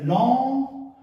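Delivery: "lent" stretched out long; slow, sustained dance cue. Shown as a demonstration. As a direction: draw out each "lent" to pace a slow movement. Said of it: "lent et après deux rapides".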